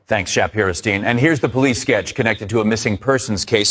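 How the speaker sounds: ordinary talk, nothing marked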